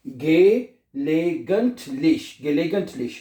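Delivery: extremely slow gelegentlich, every syllable stretched; very slow model. Shown as a demonstration. Teacher modelling the word slowly for the class. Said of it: "क्या बनेगा?".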